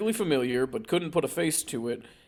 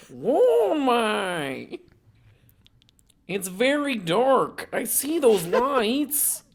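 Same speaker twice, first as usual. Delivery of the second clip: eerie high voice; 8 semitones higher, 4 dB louder, much slower than usual. Acted. Eerie voice recording acted out.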